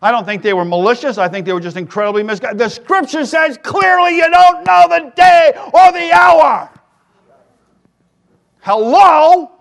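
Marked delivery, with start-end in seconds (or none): 2.6-6.7 high, loud, slow preaching shout; shouting the point. Real, not acted. Preacher to congregation, rising to a loud, slow shout. 8.6-9.5 high, loud exasperated hello; loud sarcastic hello. Real, not acted. Preacher to congregation, loud and sarcastic. Mocking rhetorical call.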